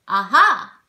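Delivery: loud, triumphant chest-voice cry; with gusto. Shown as a demonstration. As if just finding someone who was hiding, loud gusto. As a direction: pounce on it from the chest. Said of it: "with some gusto".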